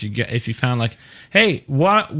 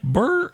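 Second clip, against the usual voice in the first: high lip-buzz fart sound; mock fart noise; 12 semitones higher than usual. Imitating someone passing gas with a buzz. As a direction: blow a silly raspberry. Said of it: "so they'd be like".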